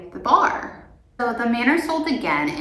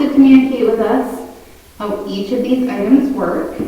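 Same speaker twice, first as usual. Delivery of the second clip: calling out to spirits; 3 semitones higher, 8 dB louder than usual. Real, not acted. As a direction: address the empty room loudly.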